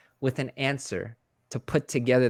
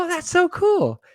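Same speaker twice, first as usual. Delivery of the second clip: retold delight; 5 dB louder than usual. His delighted reply, retold.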